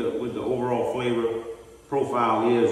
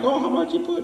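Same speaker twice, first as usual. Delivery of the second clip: mock viewer question. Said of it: "I know y'all probably like".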